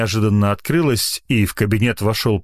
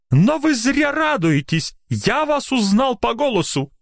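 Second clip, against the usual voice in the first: very high-pitched, excited interrogation; triumphant rage; slower than usual. A narrator's reading. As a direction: guess names furiously, pitch way up. Doctor raging at anonymous caller.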